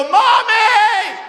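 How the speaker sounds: put-on very high, loud childlike cry; whiny child's mommy; kid wailing for mommy. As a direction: call out like a hurt little kid. Kid crying out for mom.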